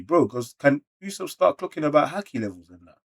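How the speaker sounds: ordinary talk, nothing marked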